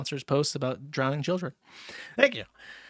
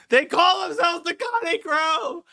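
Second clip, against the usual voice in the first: falsetto wail; 13 semitones higher than usual. Acted. Repeated slower, higher, falsetto.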